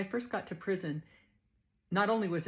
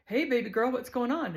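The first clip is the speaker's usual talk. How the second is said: higher, louder friendly big-woman voice; Too Tall's greeting; 6 semitones higher, 6 dB louder than usual. Retold or imitated. Too Tall greets the new girl, loud and friendly.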